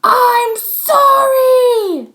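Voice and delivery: very high, very loud, very slow shout; angry yell. Acted. Max yells his apology at Chloe. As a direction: shout it in her face. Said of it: "he yelled in her face".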